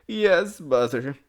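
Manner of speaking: acted high, loud child's cry; sobbing bitterly. A narrator's reading. Child answers her mother, sobbing bitterly. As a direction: sob out the admission. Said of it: "the child sobbed more and more bitterly".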